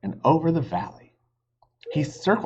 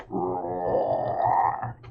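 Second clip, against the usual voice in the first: dinosaur roar; 4 semitones lower than usual. Acted. Narrator voices the T. rex.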